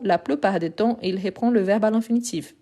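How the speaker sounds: ordinary delivery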